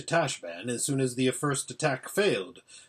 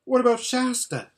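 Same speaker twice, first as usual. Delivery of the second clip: high, louder urgent asking; anxious question; 11 semitones higher, 6 dB louder than usual. A narrator's reading. Listener asks after a friend in battle, anxious question. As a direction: break in, desperate for news.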